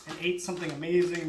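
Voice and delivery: normal talking voice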